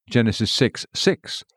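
ordinary talk, nothing marked

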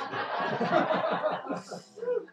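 a sudden high breathless laugh; high breathy laugh. Real, not acted. Pastor laughing at his own joke to the congregation, high breathy laugh.

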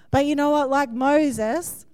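ordinary talk, nothing marked

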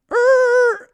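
high, loud tire screech noise. Retold or imitated. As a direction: imitate brakes squealing, one long high note. Imitates car screeching to halt.

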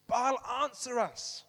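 loud, drawn-out cry to a god; shouted plea. Acted. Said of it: "they shouted".

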